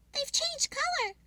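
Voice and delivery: high squirrel voice, a touch of gasp; lightly gasped in amazement. Acted. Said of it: "he gasped".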